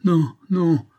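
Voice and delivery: high, loud cry of refusal. A narrator's reading. Sick woman refuses to confide in Olivia, crying out. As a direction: refuse with a sharp rise in voice. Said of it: "s'écria la femme".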